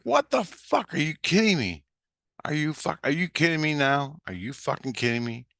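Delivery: high-pitched, exasperated protest; outraged disbelief. Retold or imitated. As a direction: retell it as a high, incredulous outburst. Acted disbelief at stripped bike.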